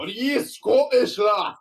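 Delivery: hammed-up Scottish accent, high, loud and slow; mock Scottish accent. Guest teasing the streamer over "scuffed" in a mock Scottish accent. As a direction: tease him in a jokey Scottish accent. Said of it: "I probably offended a lot of people when I do that".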